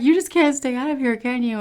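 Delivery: high, loud mock scolding; playful teasing. Doctor ribbing the patient who came back, playful teasing.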